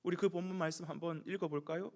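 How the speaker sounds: usual speaking voice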